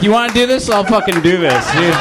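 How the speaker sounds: defiant wife. Retold or imitated. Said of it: "She's like".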